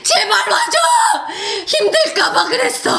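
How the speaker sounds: high, sobbing fan plea; hammed-up crying shriek, laughing underneath; imitated tearful screaming. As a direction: wail it like an overwrought fan. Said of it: "거의 울고 있었어요".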